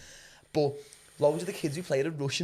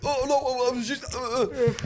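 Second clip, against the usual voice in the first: higher-pitched, stammering mock excuse; flustered friend; 7 semitones higher than usual. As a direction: lightly imitate a flustered lad caught out. Imitating friend caught, flustered.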